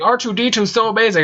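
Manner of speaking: high, gushing fangirl voice; mock the adoring fans. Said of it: "they're like".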